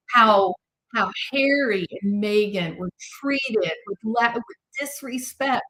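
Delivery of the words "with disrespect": usual speaking voice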